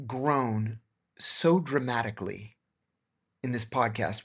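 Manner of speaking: ordinary delivery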